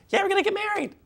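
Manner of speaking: mock-dreamy high voice for Anna; imitating Anna. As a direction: chirp it like a lovestruck princess. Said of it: "Like, when Anna says".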